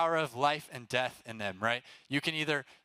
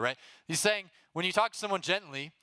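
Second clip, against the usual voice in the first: ordinary talk, nothing marked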